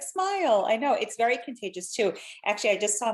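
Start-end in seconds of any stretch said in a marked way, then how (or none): 0.0-0.6 very high sing-song "Smile"; cheery command. Retold or imitated. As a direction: chirp it up high to cheer someone. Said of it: "I'm always like".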